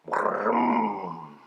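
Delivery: a low, soft car vroom; imitated engine noise. A narrator's reading. Narrator mimics the car starting with a low vroom. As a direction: rumble it like a car engine pulling away.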